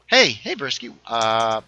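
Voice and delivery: ordinary delivery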